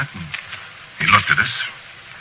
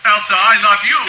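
high, impassioned call; calling out love; 4 dB louder than usual. Acted. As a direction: call across the courtroom to your wife. Husband calls out to his wife.

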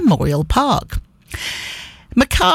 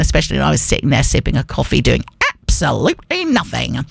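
ordinary talk

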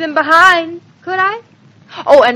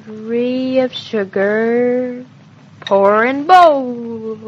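very slow, drawn-out self-talk; absorbed, dragging recipe read-out; reciting while working; slower than usual. Acted. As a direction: stretch each word as you follow the recipe. Reciting recipe to herself while cooking.

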